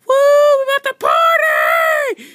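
very high, loud hyped yell; excited party shout. Pretend. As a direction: scream it like the crowd is yours. Lion King hypes the party.

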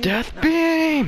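high, loud battle cry; fierce cartoon battle yell; attack shout. Firing a named attack.

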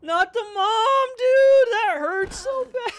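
high, loud wail of dismay; anguished outcry. Real, not acted. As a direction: wail it out, stretched and hurting. The mother is struck down.

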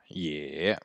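YÉ dropped low and soft; low-toned teacher's "Yé"; low third tone. Shown as a demonstration. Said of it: "donc ton bas".

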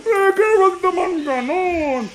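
high, drawn-out fake protest; mock whining plea. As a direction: whine it slowly as a tease. Kunwaring reklamo matapos tumawa. (kunwaring paangal na pakiusap)

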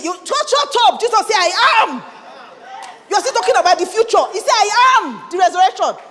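loud, high, driving sermon voice; full-force preaching. Acted. Preacher exhorts the congregation, loud and fervent. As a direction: preach it hard, climbing.

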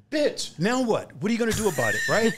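mock the bullies with a squeaky sneer; mocking tough guy. Bouncer mimicking the big guys taunting Rico.

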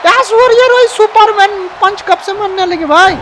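high-pitched cry of disbelief; astonished. Real, not acted. Commentator reacts to Aishwarya's Superman punch, astonished. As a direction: voice shoots up in amazed surprise.

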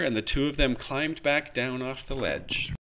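plain reading voice

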